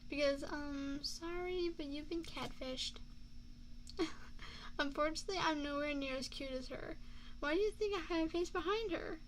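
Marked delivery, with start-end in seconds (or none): 3.0-4.7 quiet, voiceless laugh; soft chuckle. Acted. Joking to a date about catfishing, soft chuckle. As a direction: chuckle softly at your own joke.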